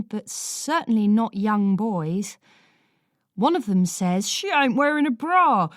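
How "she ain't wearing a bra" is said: a lightly voiced lad, cheeky; sniggering teen boy making a crude remark; teenage boy voice. A narrator's reading. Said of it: "and the other three snigger".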